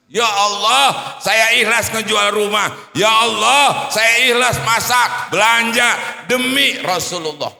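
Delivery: loud tearful pleading cry to God; crying prayer. Retold or imitated. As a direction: wail it out, heartbroken, at full voice. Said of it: "Nenek ini nangis".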